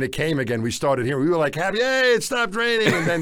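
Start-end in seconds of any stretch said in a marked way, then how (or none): 1.5-2.9 higher, bright retold cheer of relief; soaked hikers mock-celebrating a dry spell, excited. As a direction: cheer it like the rain finally quit.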